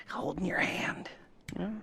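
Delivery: hushed, breathy aside; creepy whisper. Acted. Lester whispers creepily to the new partner. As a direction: lean in and whisper it close.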